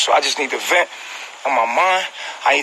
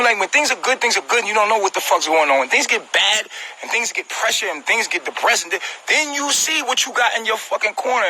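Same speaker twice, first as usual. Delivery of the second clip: usual speaking voice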